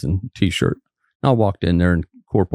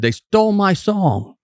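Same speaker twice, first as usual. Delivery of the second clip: higher, slow, imitating an upset man's complaint; a mock furious accusation, drawn out; retold angry Bobby; 11 semitones higher, slower than usual. Bobby cussing at the trooper about his song, mock angry. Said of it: "He just madder than hell".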